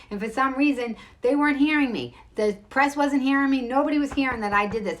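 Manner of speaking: pushing to finally be heard; fired-up frustration; indignant. Real, not acted. Indignant about not being heard.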